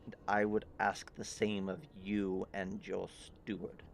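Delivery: soft, restrained voice of a tense king; low, through clenched teeth. Acted. King answering the party before him, low through clenched teeth. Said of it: "through clenched teeth almost".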